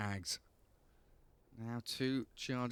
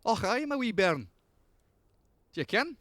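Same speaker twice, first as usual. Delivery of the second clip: hammed-up broad Scottish brogue; broad Scottish accent; 10 semitones higher, 5 dB louder than usual. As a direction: lay the Scottish brogue on thick. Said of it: "Scottish twinge to her accent".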